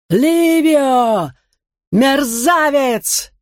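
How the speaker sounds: high, drawn-out furious howl; terrible scream. A narrator's reading. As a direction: shriek the name in rage. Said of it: "раздался ужасный вопль Каркона".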